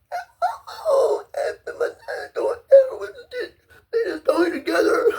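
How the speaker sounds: high, crying voice; words wobbling through tears; tearful. Real, not acted. Recalling his parents stitching his face, in tears. Crying over childhood injury.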